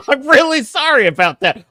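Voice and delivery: high, loud amused sorry; laughing apology. Real, not acted. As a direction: apologise through a burst of laughter. Laughing apology after promising harsh review.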